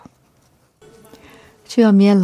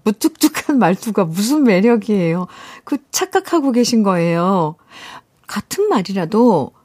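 ordinary delivery

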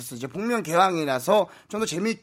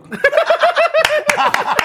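burst of laughter; 5 dB louder than usual. Real, not acted. Laughs after the bit.

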